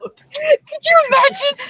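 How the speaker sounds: high, slow, drawn-out squeal of delight. Real, not acted. Host to co-hosts, gleefully imagining the prank, high and slow. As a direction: stretch it out in gleeful disbelief.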